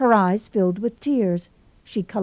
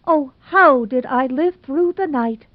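higher, louder, frantic lament; wild Hazel; 7 semitones higher, 4 dB louder than usual. Acted. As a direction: wring out the sleepless night. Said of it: "said Hazel wildly".

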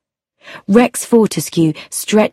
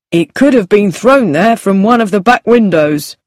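sergeant voice; 2 semitones higher, 4 dB louder than usual. A narrator's reading. Sergeant Hay's voice.

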